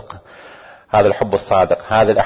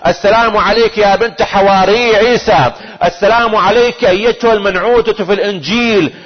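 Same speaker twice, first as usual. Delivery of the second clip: ringing prayer recitation; 10 semitones higher than usual. Acted. Preacher reciting how the Imam greets her at the shrine, ringing prayer recitation. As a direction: hail her title by title, building to a climax.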